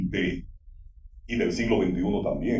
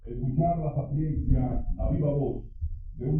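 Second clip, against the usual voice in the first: announcer voice; 7 semitones higher than usual. Acted. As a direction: hype up the guest of honor. Emcee building up the introduction.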